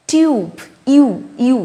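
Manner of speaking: "টিউব" with yoo, then "ইউ" isolated; U as "you". Shown as a demonstration. Teacher isolating the vowel, "টিউব" with yoo. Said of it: "ইউ ভাবেই উচ্চারণ করছি".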